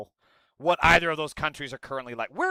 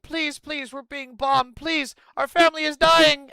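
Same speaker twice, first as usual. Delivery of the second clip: high-pitched imitation of desperate begging; high, urgent mock plea; desperate pleading; 11 semitones higher, 6 dB louder, slower than usual. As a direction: plead as bombed families, building to a loud cry. Acting out pleading bombed families.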